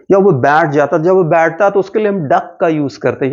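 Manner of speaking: normal talking voice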